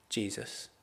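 soft, reverent recognition; hushed reverence. Acted. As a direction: drop almost to a murmur in wonder. Reverent climax of imagined heaven greeting.

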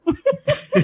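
short high-pitched giggle. Real, not acted. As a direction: burst into a quick high giggle. Laughs after her own joke.

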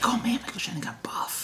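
hushed, voiceless aside of amazement; awed whisper. Retold or imitated. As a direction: whisper it as if marvelling to yourself. Retold private marvelling at an actor.

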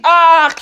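short high yell; alarmed cry. Acted. Zookeeper blinded by shattered glass, high cry. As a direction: yelp in alarm.

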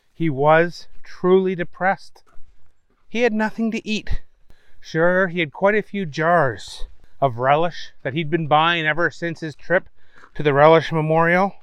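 ordinary delivery

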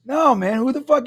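high imitated bewilderment; baffled stranger. Stranger answers the friend in line, imitated bafflement. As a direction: mimic a puzzled man's comeback. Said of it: "And he goes".

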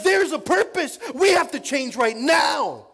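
high, slow, full-voiced shout; loud impassioned acted declaration; urgent proclamation. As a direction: cry it out like a convert with sudden conviction. Acting out Saul's new conviction.

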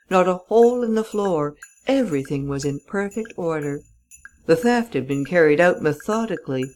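plain reading voice

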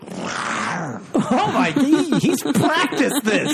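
high-pitched laughing disbelief. Real, not acted. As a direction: squeal it through laughter. Laughing disbelief at lion noise.

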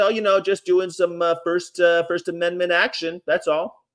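high, casual retold excuse; mock innocence. Protester explaining himself to police, high mock-innocent reply. Acted innocent answer to cops.

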